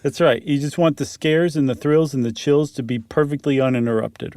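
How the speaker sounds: ordinary delivery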